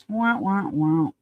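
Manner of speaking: sing-song wah wah wah, mocking; a little taunting sad-trombone sound. Mocking the lawyers with a sad trombone wah wah. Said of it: "mock sad trombone after conviction".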